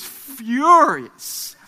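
"furious" stretched out and loud; emphatic FURIOUS. Retold or imitated. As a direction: lean hard and long on "furious". Dramatising how furious the boy was.

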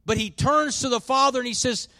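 high, loud impassioned pulpit voice; preaching at full force. Acted. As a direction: lift the congregation toward the climax.

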